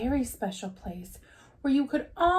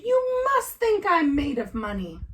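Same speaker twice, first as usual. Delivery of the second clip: higher, louder angry father voice; angry Papa; 7 semitones higher, 4 dB louder than usual. Acted. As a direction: scold the cubs in a raised voice.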